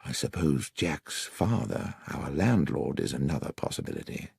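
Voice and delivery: hushed, confidential suggestion; dropped voice. A narrator's reading. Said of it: "I said, dropping my voice".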